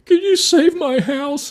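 high, pleading, drawn-out mock sob voice; imitating crying lady. Crying neighbour begging the fire chief, high pleading voice. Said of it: "she's crying".

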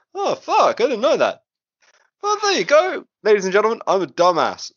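high-pitched, self-mocking dismay; embarrassed surprise. Real, not acted. Host owning up to co-hosts about a mistake, embarrassed. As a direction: squeal at your own blunder, then ease down.

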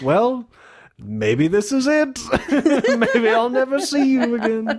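high, slow, laughing goodbye; coy mock farewell through giggles; mock wistful farewell. Acting out Lansbury's wistful farewell.

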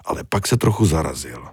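ordinary delivery

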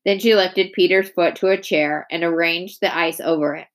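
plain reading voice

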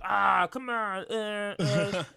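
high, loud, strained frustration; mock screaming gamer. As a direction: imitate someone yelling mid-fight at the game. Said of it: "you could be the person, like, screaming, fighting".

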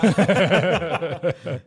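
sudden loud burst of laughter. Real, not acted. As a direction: crack up out loud.

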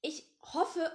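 ordinary delivery, nothing marked

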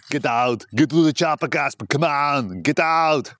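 put-on playful movie-star impersonation in a thick Austrian accent; Arnold voice. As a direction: ham up an Austrian action-star shout. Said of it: "That's a little Arnold snippet".

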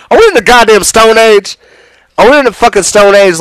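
loud, high exasperated outburst; incredulous outrage. Real, not acted. Ranter appalled at promised-land thinking, incredulous outrage. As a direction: raise voice and pitch in disbelief. Outraged rhetorical question, repeated.

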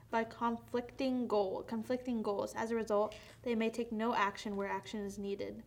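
usual speaking voice

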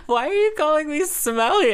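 high, laughing mock indignation; laughing protest. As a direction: whine it through a laugh. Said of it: "I was like".

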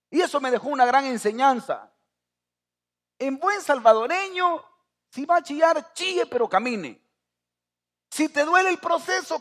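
high, loud, impassioned sermon; preacher hammering home the lesson to the church; full-force preaching. Acted. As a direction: preach it hard, building to a climax.

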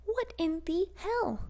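high, slow, drawn-out outrage; stunned and furious at the attacks; outraged disbelief. Real, not acted. Vlogger to viewers, stunned and outraged at the attacks.

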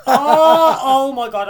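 loud, high laughing cry; laughing shriek. Real, not acted. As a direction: wail it, mortified and laughing. Mortified laughing, son may be listening.

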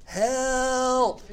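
high, pleading cry of Help; prayer cry for help. Shown as a demonstration. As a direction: call out Help like a desperate prayer. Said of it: "is one word".

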